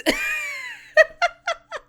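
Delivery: a very high, shrieking cackle; squealing high laugh. Real, not acted. Cackles on cutting to the oldies.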